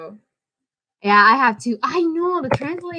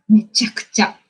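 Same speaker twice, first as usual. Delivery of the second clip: ordinary talk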